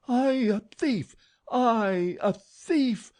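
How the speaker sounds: put-on high, loud, strangled old woman's outrage; choking with rage. A narrator's reading. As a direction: sputter it, choking on fury. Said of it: "She choked with rage".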